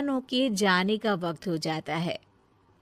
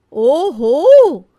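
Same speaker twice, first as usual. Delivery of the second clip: sarcastic oho; 4 semitones higher, 11 dB louder, much slower than usual. Acted. Catches her faking, sarcastic.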